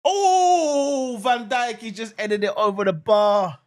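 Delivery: loud, high drawn-out "oh" easing down; excited near-miss cry. Real, not acted. Host reacting to header over the bar, loud excited call.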